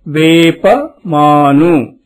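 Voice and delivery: low, careful వేప మాను; teacher modelling వేప మాను; model reading. Shown as a demonstration. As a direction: say it clearly for the class to copy. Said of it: "మిగతా మూడు అక్షరాలు".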